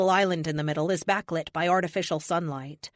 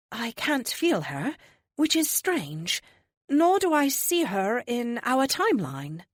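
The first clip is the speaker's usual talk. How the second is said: serene higher oracle, gently amused; 6 semitones higher, slower than usual. Acted. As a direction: speak like a calm ancient seer. Oracle character voice.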